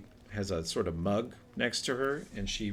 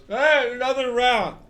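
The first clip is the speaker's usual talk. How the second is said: put-on loud, high sleepy drunk; drunk patron; 13 semitones higher, 11 dB louder than usual. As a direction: blurt it like a woken drunk. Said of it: "wakes up and goes".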